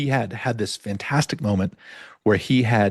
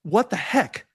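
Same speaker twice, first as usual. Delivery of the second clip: higher, excited disbelief; retold amazement; 8 semitones higher than usual. Telling the podcast host how the ankle felt, amazed and high. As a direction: squeal it out in happy shock. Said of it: "And I was like".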